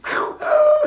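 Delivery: high sharp intake of breath; sharp gasp. Retold or imitated. Skydiver feeling the chute pull, sharp gasp. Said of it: "It's like three seconds of free fall".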